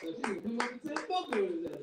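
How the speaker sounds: ordinary talk, nothing marked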